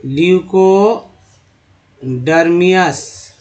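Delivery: ल्यूकोडर्मियस drawn out, syllable by syllable, falling at the end; slow model pronunciation. Shown as a demonstration. Teacher showing students how to say a word, slowly.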